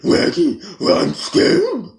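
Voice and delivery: high, loud puffed-cheek cartoon dog; Scooby-Doo voice with puffed cheeks; puffed cheeks mocking. Shown as a demonstration. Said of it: "when I puff my cheeks doing Scooby".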